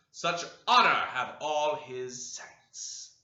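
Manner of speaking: loud, high thundering sermon; preaching denunciation. Acted. Preacher Jabes condemning the sinner to the brethren, thundering. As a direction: condemn him from the pulpit.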